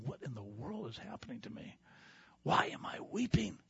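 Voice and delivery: hushed, breathy near-whisper of confusion; bewildered inner voice. Retold or imitated. Pastor voicing his own confused thoughts, hushed whisper. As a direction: whisper it as if to yourself, lost.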